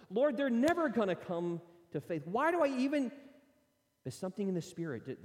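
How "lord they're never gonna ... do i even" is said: higher, louder exasperated cry to God; frustrated complaint. Retold or imitated. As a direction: vent the frustration upward. Frustrated prayer quoted.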